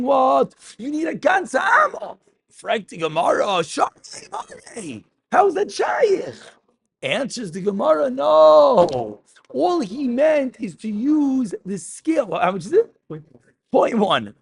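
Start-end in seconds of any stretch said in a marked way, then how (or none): 0.0-2.2 high, shouted indignant demand; mock outrage. Teacher voicing a scandalized demand, high and shouted. 3.6-6.3 very high incredulous cry; mock protest. Teacher voicing the Gemara's astonished objection, very high. 8.1-12.4 answering voice. Acted. Voicing the Gemara's answer.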